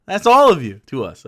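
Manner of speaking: usual speaking voice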